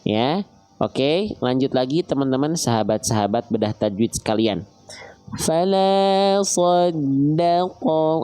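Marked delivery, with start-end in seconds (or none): 5.4-8.2 fala sodako slowly chanted; Quran recitation. Shown as a demonstration. Teacher recites the verse again for students. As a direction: draw out fala sodako in tajwid style.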